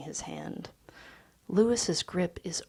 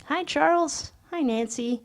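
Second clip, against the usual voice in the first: much higher, gushing greeting; effusive high voice; 9 semitones higher, 7 dB louder than usual. Acted. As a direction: gush over old friends. Louis greets friends effusively.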